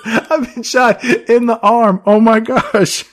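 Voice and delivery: a wounded bystander whining, played for laughs; hammed-up shot man. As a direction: ham up a bystander whining about his wound. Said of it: "He does".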